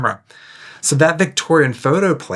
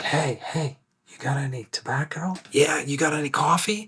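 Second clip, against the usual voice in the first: whispering; 4 semitones higher, 6 dB quieter than usual. Retold or imitated. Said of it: "be like".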